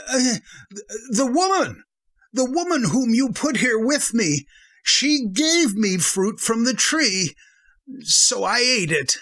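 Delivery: man blaming the woman before God; nervous blaming. Acted. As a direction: pass the blame nervously.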